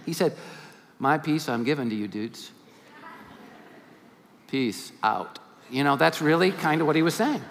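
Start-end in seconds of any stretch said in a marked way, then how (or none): 1.0-5.4 soft, lower, laid-back sign-off; casual farewell. Retold or imitated. Jesus says goodbye to his disciples, a soft laid-back farewell. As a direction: toss it off like a cool friend leaving.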